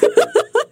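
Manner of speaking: high, bright laugh at the tease; real amused laugh; burst of laughter. Real, not acted. Guest laughs at the teasing.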